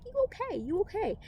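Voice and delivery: high, sing-song soothing of yourself; self-reassurance. Retold or imitated. Believer calming herself to avoid risk, high sing-song reassurance. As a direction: pat yourself on the back in a high voice. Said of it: "you're like".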